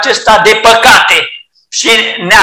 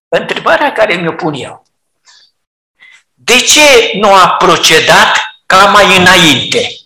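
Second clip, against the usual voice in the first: ordinary talk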